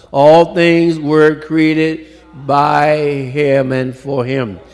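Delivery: loud, slow, soaring pulpit preaching; booming sermon cadence; preaching at full force. Acted. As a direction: ring it out to the congregation.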